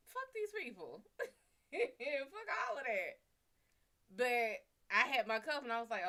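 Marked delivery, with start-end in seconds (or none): 0.1-3.2 high, dismissive squeal; mock outrage. To her boyfriend about the strict teacher, high scoffing squeal.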